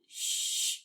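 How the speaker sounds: soft unvoiced shh for each rest; shushing rests. Shown as a demonstration. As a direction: hush each rest out softly. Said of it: "we shh".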